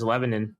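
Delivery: Lebanon with a reduced last syllable, "nin"; Lebanon ending in -nin. Shown as a demonstration. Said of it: "I've always just heard it as".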